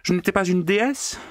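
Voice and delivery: very high, mocking demand; taunting maid. A narrator's reading. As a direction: needle him for an answer. Natasha taunting the pig.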